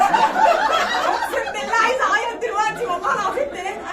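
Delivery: loud, high laughing protest; laughing while insisting she wanted to cry; laughing hard. Real, not acted. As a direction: swear it through helpless laughter. Laughing about crying at prayer.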